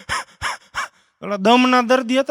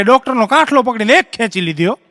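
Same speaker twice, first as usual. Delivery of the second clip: ordinary delivery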